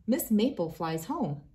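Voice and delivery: plain reading voice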